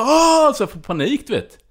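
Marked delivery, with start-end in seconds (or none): 0.0-0.5 high loud mock gasp of alarm; imitating a frightened cry; panicked gasp. Girlfriend's panicked gasp retold.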